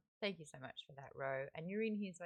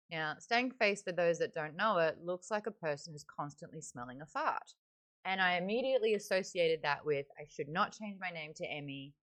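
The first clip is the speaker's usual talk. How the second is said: usual speaking voice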